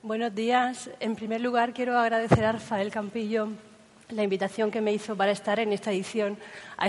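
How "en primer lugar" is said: ordinary talk, nothing marked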